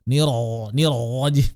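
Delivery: niro with a guttural throat r; throaty ro. Shown as a demonstration. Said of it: "dipindahin ke tenggorokan". (ro tenggorokan)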